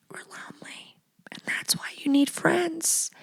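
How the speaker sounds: unvoiced confiding whisper; hushed confession to the listener. Real, not acted. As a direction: whisper it like a secret. Confessional aside.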